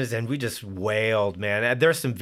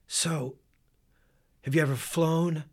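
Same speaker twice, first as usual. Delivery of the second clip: Joe Savage's voice; 6 semitones higher than usual. Retold or imitated. Joe Savage asking the young player, lightly imitated Italian voice. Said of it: "he talks like this".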